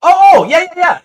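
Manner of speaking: loud, high burst of recognition; sudden realisation. Real, not acted. Host catching on to the guest's question, sudden realisation. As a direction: exclaim as it suddenly clicks. Suddenly understands which gallery is meant.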